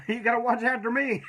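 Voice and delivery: cracking up at his own line; giggling while the story wraps up; laughing. Real, not acted.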